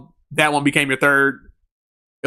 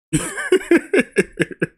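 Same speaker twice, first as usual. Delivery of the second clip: high giggle; 12 semitones higher than usual. Real, not acted. Laughing at cannibalism joke.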